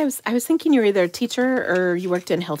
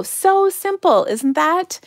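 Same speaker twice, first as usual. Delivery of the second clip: excited; 8 semitones higher, 5 dB louder, slower than usual. Real, not acted. Delighted with finished card.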